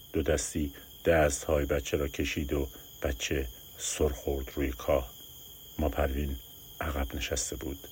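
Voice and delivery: usual speaking voice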